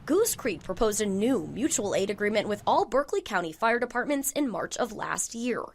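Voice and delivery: measured, emphatic reporter voice-over; TV news read. A narrator's reading. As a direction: deliver it as an investigative news report. Reporter narrates a news package.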